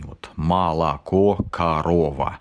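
молоко and корова with unstressed o as a; akanye demo. Shown as a demonstration. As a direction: say молоко as malako and корова as karova. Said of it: "так называемый акающий".

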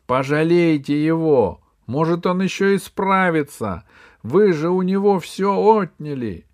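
loud, high impassioned appeal; pleading cry. A narrator's reading. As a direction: cry out for mercy, unable to hold back. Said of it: "не выдержала и крикнула". (громкая отчаянная мольба)

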